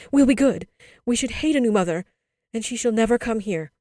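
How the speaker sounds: put-on high, loud childish vow; cried out. A narrator's reading. Children reject any new mother, crying out. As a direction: vow it urgently and loudly. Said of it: "they cried".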